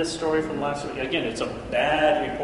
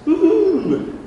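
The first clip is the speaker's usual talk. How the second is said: high whooping woo-hoo; a sudden happy whoop; mock cheer; 7 dB louder than usual. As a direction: cheer it like a fan. Cheering the good report.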